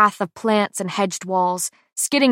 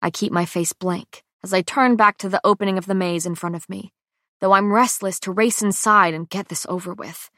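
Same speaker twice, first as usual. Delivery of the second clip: plain reading voice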